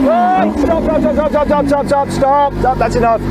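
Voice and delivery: urgent shout to cut the engine; shouting over the engine. Real, not acted. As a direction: yell to be heard over the V12. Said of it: "Otherwise, no one will hear me".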